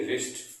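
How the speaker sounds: रिस्ट starting straight on R; correct pronunciation. Shown as a demonstration. Teacher gives students the correct reading, रिस्ट with silent W. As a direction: drop the W, say रिस्ट. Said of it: "इसको पढ़ते हैं".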